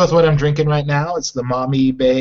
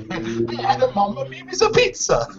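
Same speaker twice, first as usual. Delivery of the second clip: slow, hammy fake Italian accent; 6 semitones higher than usual. Shown as a demonstration. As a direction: put on a cartoon Italian accent. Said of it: "Can you say that in a fake Italian accent for me?".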